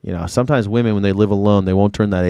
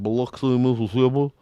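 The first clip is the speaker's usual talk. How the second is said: mock Mississippi accent, "Biloxi" as buh-LUK-suh; slack-jawed Mississippi accent; 2 semitones higher than usual. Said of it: "they got that slack-jawed accent, man".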